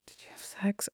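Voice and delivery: hushed awkward ask; whispered question. Retold or imitated. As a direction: drop to a secret whisper.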